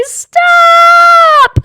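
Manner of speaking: long, high full-voiced yell; very loud yell. A narrator's reading. Taya picked up by the hair, yelling very loudly. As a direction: scream it out and hold it. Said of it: "Taya yelled very loudly".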